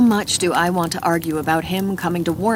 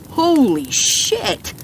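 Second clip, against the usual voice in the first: slow, drawn-out shocked holy shit; shocked exclaim; 7 semitones higher, 3 dB louder, much slower than usual. A narrator's reading. Gunner stunned at the knife, slow shocked exclamation. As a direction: gape at the knife.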